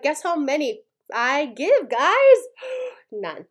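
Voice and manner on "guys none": teasing reveal. Real, not acted.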